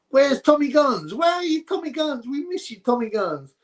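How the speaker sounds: high sing-song call for a missing viewer; playful calling out. Real, not acted. Streamer calling out to a missing regular viewer, high sing-song. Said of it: "calling a missing viewer".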